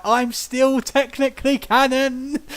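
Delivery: high, drawn-out mock Brooklyn protest; Brooklyn accent. Brooklyn Vulcan defends himself to the group, high Brooklyn accent. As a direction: insist on it in a hammed-up Brooklyn voice. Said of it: "we have this Brooklyn Vulcan that's just like".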